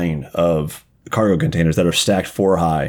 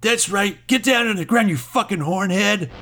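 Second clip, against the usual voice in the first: higher, taunting thug voice ordering her down; sneering ganger; 11 semitones higher than usual. Acted. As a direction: bark it like a cocky street thug. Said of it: "And he's like".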